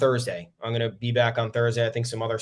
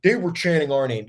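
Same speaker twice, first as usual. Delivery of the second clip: mock Houston complaint; 4 semitones higher than usual. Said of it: "Houston doing this whole campaign of, like".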